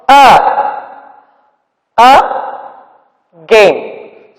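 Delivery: "a, a, gain" with long gaps between syllables; split into syllables. Shown as a demonstration. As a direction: break "a, a, gain" apart, pausing after each part. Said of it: "So two sounds are coming here".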